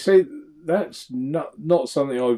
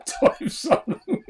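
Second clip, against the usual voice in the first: laughing. Real, not acted. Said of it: "laughing while talking".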